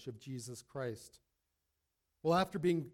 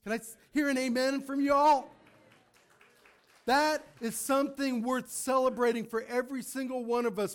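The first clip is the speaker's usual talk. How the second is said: loud, high preaching to the congregation; full-force preaching; 6 semitones higher, 5 dB louder than usual. Acted. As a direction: rouse the church at full voice.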